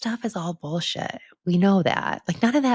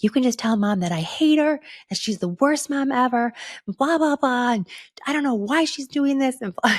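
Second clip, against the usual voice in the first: imitating angry teen; 10 semitones higher than usual. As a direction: retell her daughter's outburst in a shrill mock yell. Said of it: "I let her yell".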